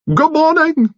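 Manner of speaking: very high, loud fluting greeting; fluted thickly. A narrator's reading. Mister Finn greeting the detectives in a fluting voice. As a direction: flute it out theatrically. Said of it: "Mister Finn fluted thickly".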